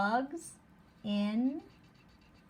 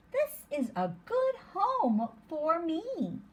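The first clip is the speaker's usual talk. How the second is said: higher, slower happy bug; lightly voiced bug; 7 semitones higher, slower than usual. Teacher rereading the bug's line to the class. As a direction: beam like a bug that found its home. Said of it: "says the bug".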